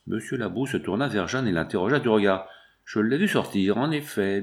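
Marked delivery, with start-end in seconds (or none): none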